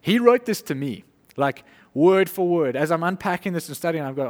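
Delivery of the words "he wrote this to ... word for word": louder and higher, slowing on word for word; amazed discovery. Retold or imitated. Preacher to congregation, retelling his Bible study, amazed and louder. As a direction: marvel that the letter was written to you. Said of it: "I'm reading these two books and I'm going like".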